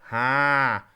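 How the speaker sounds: a sharp, loud, pressed "Ha!"; pressed twang. Shown as a demonstration. Coach demonstrates the singer's twang with a pressed loud Ha. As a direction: bark out a bright twangy "Ha!". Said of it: "nasal and oral twang coming through here".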